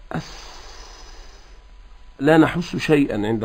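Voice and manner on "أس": "أس-" as a faint hiss with no voice; unvoiced s. Shown as a demonstration. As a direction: breathe out "أس-" as a soft voiceless hiss. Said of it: "ولو قلنا".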